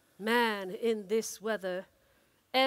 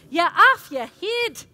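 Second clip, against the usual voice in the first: loud high scolding put-on voice; 7 semitones higher, 12 dB louder than usual. M's voice scolding the dreaming driver, loud high scold. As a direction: tell him off from the sky. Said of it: "and M's voice".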